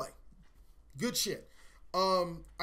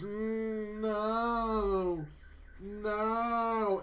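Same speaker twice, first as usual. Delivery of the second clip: a lightly voiced stubborn shoulder, whiny no; shoulder refusing; 3 semitones higher, much slower than usual. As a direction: play the shoulder, mildly whining its refusal. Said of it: "this shoulder goes".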